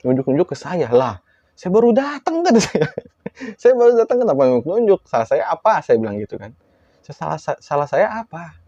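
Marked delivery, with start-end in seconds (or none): 0.9-2.5 high, drawn-out incredulous retort; indignant protest. Retold or imitated. Teller retorts to the pointing possessed woman, mock-indignant protest. 3.6-5.8 high, loud-starting bewildered complaint; joking outrage at being blamed; indignant protest. Retold or imitated. Teller asks possessed woman what he did, mock-indignant. 7.1-8.6 mock protest. Teller asks possessed woman his fault, mock-innocent.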